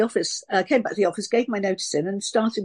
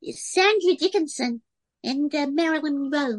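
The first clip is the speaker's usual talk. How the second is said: high, cutesy American voice; slow, high American girlie character; cute American moll; 7 semitones higher than usual. Shown as a demonstration. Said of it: "she was really cute".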